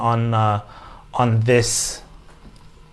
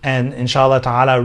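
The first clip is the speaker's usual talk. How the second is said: ordinary talk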